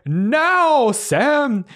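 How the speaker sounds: a loud, high call of "Now, Sam!"; shouted signal. Acted. Mister Marrow shouting the cue to Samantha, loud and high. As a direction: yell the cue across the theater.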